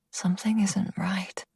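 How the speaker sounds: soft unvoiced whisper, sensing danger; uneasy whisper. A narrator's reading. Brooke senses danger and whispers to herself. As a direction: whisper it as dread creeps in.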